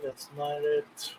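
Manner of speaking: very quiet, low self-talk while searching; muttering to oneself. Real, not acted. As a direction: think aloud under your breath, barely voiced. Muttering to himself while searching bookmarks.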